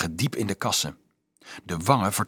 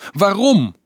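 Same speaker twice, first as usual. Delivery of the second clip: a high, loud tearful why; tearful cry; 10 semitones higher, 7 dB louder than usual. A narrator's reading. Dolf weeping over the dead girl, crying out. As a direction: cry out in grief at the dead child. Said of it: "Tranen liepen over zijn wangen".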